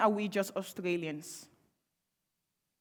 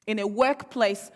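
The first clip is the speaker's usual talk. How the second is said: ordinary talk, nothing marked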